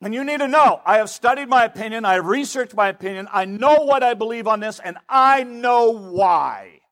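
high-pitched, insistent declaration stretching out at the end; adamant self-certainty. Acted. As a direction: insist you are absolutely right, drawing out the last words. Playing an opinionated, insistent self.

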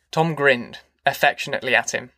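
ordinary delivery, nothing marked

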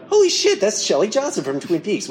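re-enacted gasp of discovery, pitched high at first; excited recognition. Host retelling spotting an actor in an old episode, excited recognition. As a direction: blurt it out high, then settle.

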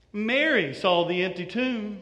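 ordinary talk

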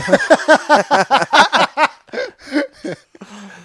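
high stretched cackling laugh; real laughter; long laugh. Real, not acted. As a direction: roll with laughter. Laughs at own quote.

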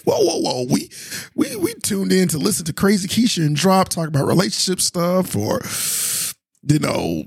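puzzled listener. Retold or imitated. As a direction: play a confused fan objecting, hammed-up. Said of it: "I'm sure some listeners like".

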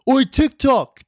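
high-pitched imitation of a heckler calling out; high, hollered impression of a fan; mock yell. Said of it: "please don't yell out".

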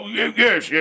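high, garbled backwoods impression; bayou snake handler. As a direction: babble like a crazy bayou snake man. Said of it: "crazy ass snake handler bayou guy".

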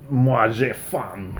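slow, badly spoken French; clumsy French-accent imitation; terrible French accent. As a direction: imitate someone mangling French, drawn out. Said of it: "in a very terrible French accent".

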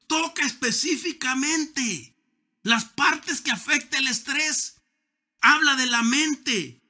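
high, loud, full-force sermon delivery; fiery preaching. Acted. Pastor exhorting his congregation against stress, fiery preaching. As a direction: preach it out with rising fervor to the pews.

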